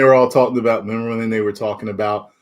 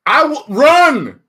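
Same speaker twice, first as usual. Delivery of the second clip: mock declaration; 7 dB louder, slower than usual. Hyped declaration.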